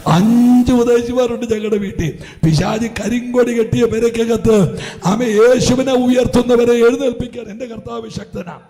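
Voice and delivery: loud, fervent pulpit proclamation; rising revival preaching; preaching at full force. Acted. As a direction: declare it to the church with conviction.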